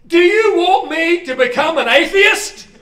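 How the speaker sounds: high, loud desperate plea; boy's last desperate cry up to God. Retold or imitated. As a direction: throw it at the sky in despair. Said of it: "with the most desperate look".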